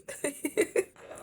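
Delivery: a quick laugh breaking out; short high laugh. Real, not acted. Laughing at the absurdity.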